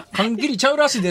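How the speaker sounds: Kansai dialect as a Tokyo person does it; fake Kansai dialect. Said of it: "典型的な関東人の喋る関西弁". (関東人の真似した関西弁)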